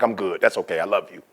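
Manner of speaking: low, soft, letting it go; gentle resigned dad. Acted. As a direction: softly turn down the offer. Dad gently declines the offer.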